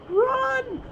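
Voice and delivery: very high yelp; panicked squeal. Real, not acted. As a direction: squeal it while running away. Fleeing the boss.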